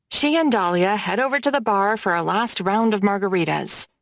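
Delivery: ordinary delivery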